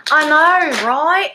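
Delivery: high, playful mock Australian; put-on Aussie accent. Reader agreeing with chat, put-on Aussie accent. Still playing with random accents.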